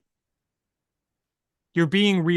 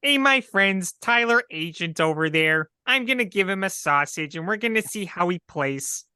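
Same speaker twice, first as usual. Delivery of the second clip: put-on Chicago accent; 3 semitones higher than usual. Co-host mocking local fans in a hammed-up Chicago accent. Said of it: "I can't even do a Chicago accent".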